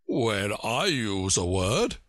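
slow, scornful and lofty; a touch of scorn, slow. A narrator's reading. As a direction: drag it out with scorn. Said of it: "in a rather scornful tone".

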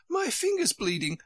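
very high, alarmed character cry; queen shouting. A narrator's reading. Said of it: "shouted the Queen".

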